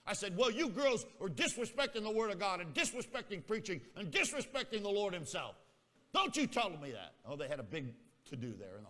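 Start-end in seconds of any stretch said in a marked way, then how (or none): 0.3-7.2 righteous indignation. Retold or imitated. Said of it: "filled with righteous indignation".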